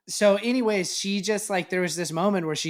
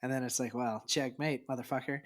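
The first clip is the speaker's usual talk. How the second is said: quiet; 3 semitones lower, 8 dB quieter than usual. Retold or imitated. Quiet punchline.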